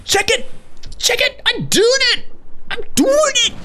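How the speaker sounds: very high delighted cries; very high victorious squealing; triumphant squeal. Real, not acted. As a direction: crow with glee at your success. Triumphant success in game.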